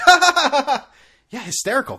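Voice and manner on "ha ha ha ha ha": loud, high canned sitcom laughter; mock laugh track. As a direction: ham up a laugh track cackle. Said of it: "everyone's like".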